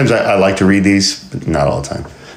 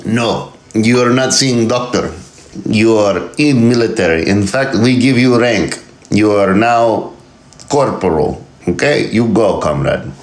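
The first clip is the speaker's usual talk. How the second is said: mock Russian officer; 4 semitones higher than usual. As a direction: bark orders like a comic Russian officer. Russian officer voiced in skit.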